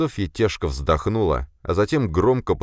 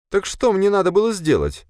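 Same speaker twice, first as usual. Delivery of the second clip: much higher voice, indignant protest; 4 dB louder than usual. A narrator's reading. Sofia protests to her boss, indignant. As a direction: protest high, stung by the rebuke. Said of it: "возмутилась Софья".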